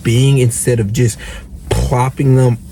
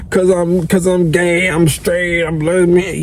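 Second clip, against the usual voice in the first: higher-pitched mocking excuse-maker; poor-me voice, mockingly imitated; mock victim; 5 semitones higher than usual. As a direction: rattle through excuses in a put-on victim voice. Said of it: "this victim mentality".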